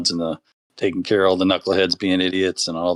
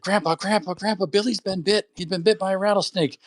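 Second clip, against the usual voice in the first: put-on much higher, breathless kid calling for grandpa; panicked kid; 11 semitones higher than usual. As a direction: cry for help like a frightened boy. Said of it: "He's like".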